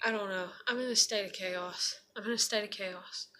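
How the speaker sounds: low, very soft, drained; subdued confession. Real, not acted. Vlogger admitting she is in chaos, subdued. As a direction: admit it quietly, almost to yourself.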